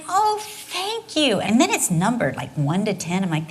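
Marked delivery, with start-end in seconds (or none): 0.1-1.4 higher, slow, touched; wife recalls thanking husband for the supposed love note, gushing; gushing thanks. Retold or imitated. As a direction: draw out a sweet thank you. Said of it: "And I'm all excited".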